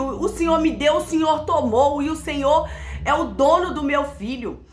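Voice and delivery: tearful prayer. Retold or imitated. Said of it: "comecei a chorar e falar".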